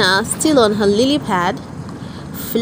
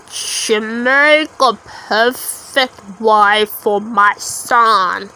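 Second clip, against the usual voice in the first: slow, lower croak of a toad; 3 semitones lower, much slower than usual. A narrator's reading. Ugly toad plotting a wife for his son, slow croak. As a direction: croak it out slowly like an old toad. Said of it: "he croaked".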